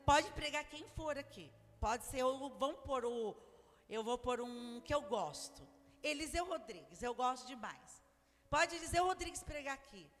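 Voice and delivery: loud, rising pulpit preaching; full-force pulpit sermon. Acted. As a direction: preach it out with fire.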